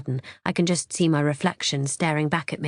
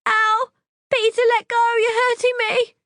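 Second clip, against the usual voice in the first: very high, loud cry of pain; high pained pleading; pained sobbing cry; 5 dB louder than usual. Acted. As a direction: yelp and beg him to stop. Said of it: "She's sobbing now, pleading".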